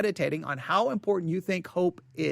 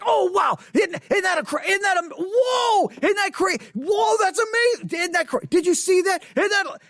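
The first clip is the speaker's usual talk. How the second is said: overexcited shouts; 12 semitones higher than usual. Retold or imitated. Pastor imitates himself shouting at his wife during a movie, much higher. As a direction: gush like a kid at a movie. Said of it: "I'm like".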